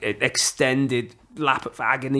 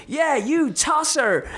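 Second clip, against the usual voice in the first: high, slow drawn-out jeer; mock heckle; 12 semitones higher, much slower than usual. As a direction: jeer at the passing runner, stretching the insult. Heckled by a stranger.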